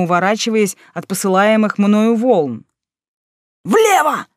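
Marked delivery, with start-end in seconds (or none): 3.6-4.3 high frantic yell; frantic shout. A narrator's reading. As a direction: bark the warning in panic. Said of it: "орал Мик не своим голосом".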